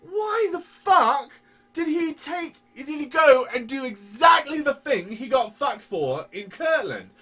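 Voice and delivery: high, loud, shouting disbelief; incredulous rant. Real, not acted. Ranting to cohost about Joseph Smith, loud incredulous shouting. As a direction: rant at the top of the voice, stretched out.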